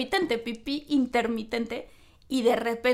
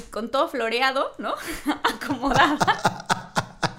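ordinary delivery

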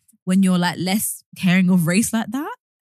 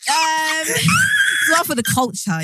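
mock shout; 7 semitones higher, much slower than usual. Mock outraged back chat.

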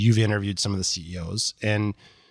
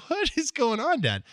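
much higher, voicing startled girls; alarmed daughters; 14 semitones higher than usual. Daughters catching dad yelling, high alarmed voice. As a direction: pitch up like a worried kid. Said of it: "my daughters were like".

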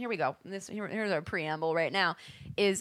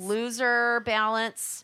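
drawn out; 3 semitones higher, much slower than usual. Real, not acted. Drawn-out mocking phrase.

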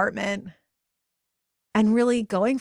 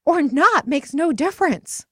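passionate proclamation; 9 semitones higher, 8 dB louder than usual. Retold or imitated. Friend declaring every woman's purpose, loud and fervent. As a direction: proclaim it like a rallying cry. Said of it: "she just went on and said".

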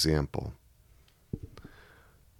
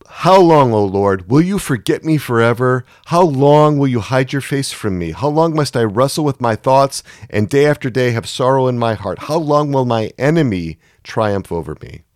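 loud, higher cry of how long; anguished demand, voice raised high; angry lament; 6 semitones higher, 5 dB louder than usual. Acted. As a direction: hurl the question at God. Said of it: "You can hear the anger behind".

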